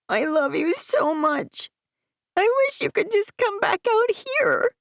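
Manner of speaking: put-on high child's voice, yearning; a son begging mom to move; child voice pleading; pleading boy. Aiden pleading.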